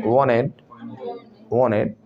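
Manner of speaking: वांटेड as "wonned", no T after N; American T-dropping. Shown as a demonstration. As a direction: say वांटेड like "wa-ned". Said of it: "N के बाद टी भी हटा देंगे".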